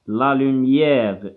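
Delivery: La lumière drawn out very slowly with the è stretched; La lumière, slow and elongated for dictation; stretched pronunciation. Shown as a demonstration. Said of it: "كنجبدوها شوية في النطق".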